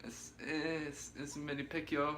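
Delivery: Manny Pacquiao. Acted. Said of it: "how does Manny Pacquiao sound?".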